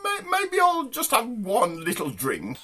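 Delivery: very high, slow mock lady's voice; posh woman. Posh woman giving in to one drink, high put-on voice. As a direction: give in slowly in a falsetto posh voice.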